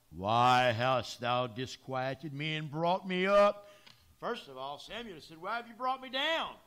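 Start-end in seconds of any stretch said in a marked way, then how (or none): 0.1-3.7 loud, slow, lower voice of the risen Samuel; booming rebuke. Acted. Samuel's spirit booming at Saul for disturbing him. 5.4-6.6 indignant. Acted. Samuel's rebuke paraphrased.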